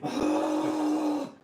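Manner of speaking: high strained moaning groan; zombie groan. Pretend. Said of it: "that noise you made was funny".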